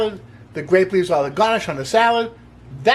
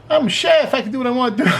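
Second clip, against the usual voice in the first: mock cocky boast; 5 semitones higher, faster than usual. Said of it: "I can't say that with a straight face".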